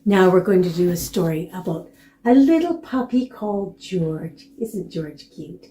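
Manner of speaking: usual speaking voice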